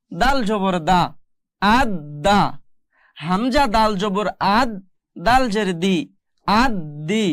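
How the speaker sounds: slow spelling, then "আদ দা", "আদ দি", "আদ দু" with a held d; spelling drill. Shown as a demonstration. Teacher shows the next examples, slow drill with doubled d in "আদ দু". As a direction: spell each part and lean on the double d in "আদ দা". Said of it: "দেখুন এখানে একটু ভালো করে".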